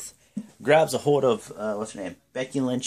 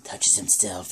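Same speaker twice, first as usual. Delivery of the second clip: softly lowered mock-sultry voice; sexual talk; 5 semitones lower, 6 dB quieter than usual. Describing the wrestler's sexual manner, softly mock-sultry. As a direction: lightly imitate a seductive talker. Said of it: "He's always all so sexual and talks like this".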